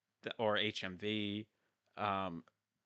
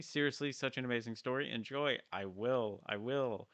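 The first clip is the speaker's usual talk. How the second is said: ordinary talk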